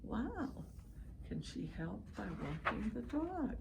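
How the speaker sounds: normal talking voice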